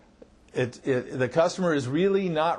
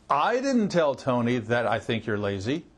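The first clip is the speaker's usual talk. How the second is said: opening "I" punched, the rest drops lower; stress on "I"; 5 semitones lower than usual. Shown as a demonstration. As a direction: hit the word "I", let the rest fall away into a questioning rise. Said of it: "change the meaning of that sentence by emphasizing".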